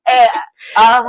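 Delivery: high, loud, laughing outcry; loud, high, laughing protest; excited shout. Real, not acted. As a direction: cry it out in joyful protest.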